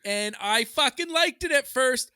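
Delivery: loud, high-pitched fed-up rant; pissed off. Real, not acted. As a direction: rail at it, shouting. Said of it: "starting to piss me the fuck off".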